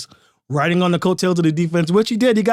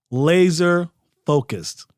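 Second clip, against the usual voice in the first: Laser focused drawn out slowly, each word weighted; slow right down and lean on "Laser focused"; deliberate slow repeat of "Laser focused" for emphasis; slow, emphatic repeat; much slower than usual. Shown as a demonstration. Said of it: "they are laser focused".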